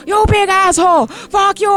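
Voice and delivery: high-pitched imitation of a furious rant; hammed-up accented yell; mock scream. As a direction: mimic someone screaming insults, pitched high. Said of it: "screaming at the top of his lungs".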